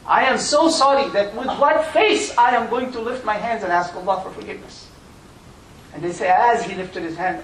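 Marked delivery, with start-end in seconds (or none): 0.1-4.9 higher, louder cry of shame; anguished shame. Retold or imitated. Young man tells the elder of his shame, anguished. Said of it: "He said".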